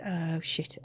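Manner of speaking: usual speaking voice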